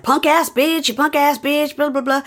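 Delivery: high, hammed-up insult chant; mock furious. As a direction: imitate a furious man ranting on repeat. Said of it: "Jeff is furious and he's like".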